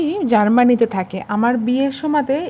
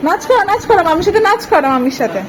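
put-on baby talk; 4 semitones higher than usual. Mother asking the baby what she eats, high baby talk. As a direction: chirp at the baby over her watermelon. Coaxing baby to sing, dance.

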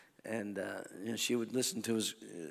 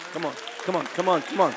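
loud, raised rallying call; urging the crowd; 6 semitones higher, 11 dB louder, faster than usual. Real, not acted. Preacher urging the congregation to give God a shout, loud rallying call.

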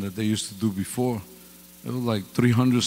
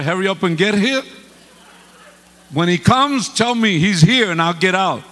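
rising louder as he insists on the seat; mock indignant protest; 6 semitones higher, 5 dB louder than usual. To the usher, a mock indignant protest. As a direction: put out, arguing the usher down.

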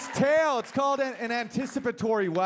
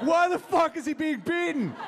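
outraged high yelp; 9 semitones higher than usual. Retold or imitated. Drunk viewer outraged at ad.

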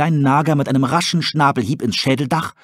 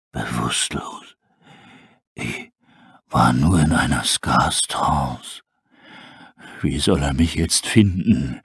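very low, slow breathy wolf rasp; a dying wolf barely whispering; weak wolf; 6 semitones lower than usual. Acted. Starving wolf asking about his prophet, faint rasp.

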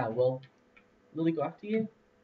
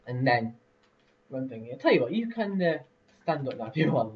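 ordinary talk

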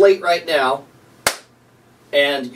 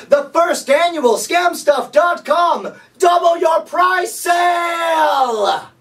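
high, slow, booming announcer yelling the deal; shouted sales announcement; 11 semitones higher, 3 dB louder, slower than usual. Acted. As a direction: sell it like a carnival barker. Mock infomercial announcement.

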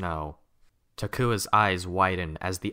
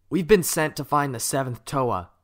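higher, louder eager telling; eager rush; 7 semitones higher, 6 dB louder than usual. A narrator's reading. Jaller eagerly explains their mission to Kopaka. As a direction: spill the news out eagerly. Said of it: "the words tumbling out of him eagerly".

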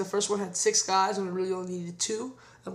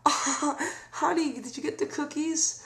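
higher, slow, sweet greeting; Foley as polite host; 7 semitones higher, much slower than usual. Retold or imitated. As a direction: play the cosy host at the door. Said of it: "he go".

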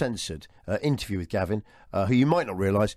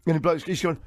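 ordinary delivery

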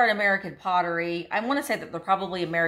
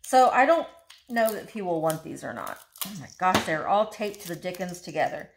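ordinary talk

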